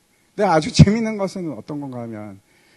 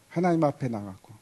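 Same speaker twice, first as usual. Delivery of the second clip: usual speaking voice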